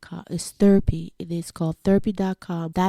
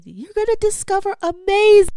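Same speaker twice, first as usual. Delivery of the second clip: excited; 12 semitones higher, 7 dB louder than usual. Real, not acted. Excited motivational exclamation.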